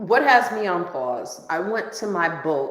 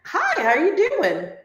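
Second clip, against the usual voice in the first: friendly greeting; 8 semitones higher than usual. Retold or imitated. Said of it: "So I go down and I'm like".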